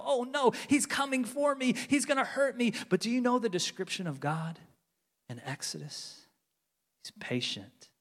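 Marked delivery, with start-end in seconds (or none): 0.0-2.7 mock fear. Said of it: "We go".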